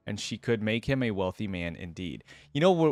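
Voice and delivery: ordinary delivery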